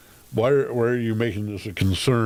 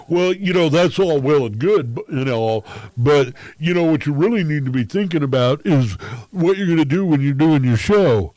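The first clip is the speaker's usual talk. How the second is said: condescending lecture; 6 semitones higher, 5 dB louder than usual. Retold or imitated. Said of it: "I'm kinda like".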